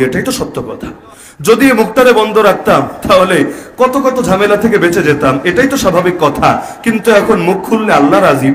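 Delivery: normal talking voice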